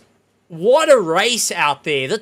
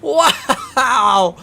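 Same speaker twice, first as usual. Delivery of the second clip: loud disbelieving laugh then wow; stunned laugh; 6 semitones higher, 6 dB louder than usual. Real, not acted. Commentator laughing at the wild finish, stunned. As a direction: laugh at the chaos.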